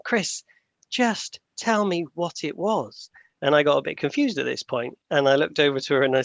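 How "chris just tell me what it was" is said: higher, slow and insistent; imitating an irate girlfriend, each word dragged out. Girlfriend losing patience over the badger, higher and slow. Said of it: "She got really irate".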